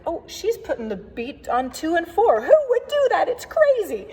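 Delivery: high, squeaky mock outrage; mock scandalized churchgoer. Organist mocking a shocked congregant, high squeaky outrage. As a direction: play the shocked old-timer clutching her pearls.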